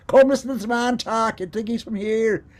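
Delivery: high, loud Irish lilt; Irish accent calling out. Retold or imitated. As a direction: call your friends over in an Irish accent. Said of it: "calling their friends".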